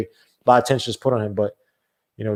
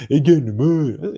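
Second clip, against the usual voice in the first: slow, put-on Geechee Southern drawl; drawn-out mock down south accent. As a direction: replay the line in a bad Southern accent. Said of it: "why did I say it like that".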